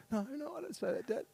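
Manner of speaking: put-on a child insisting she's innocent. Daughter answering her father.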